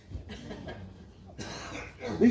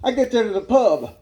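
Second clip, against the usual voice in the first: normal talking voice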